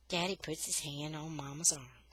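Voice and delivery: ordinary delivery, nothing marked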